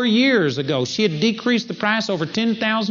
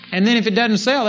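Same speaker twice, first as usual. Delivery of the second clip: usual speaking voice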